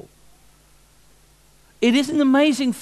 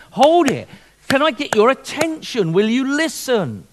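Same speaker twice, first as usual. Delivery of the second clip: urgent call; 6 semitones higher, 3 dB louder than usual. Acted. Bible voiced breaking in.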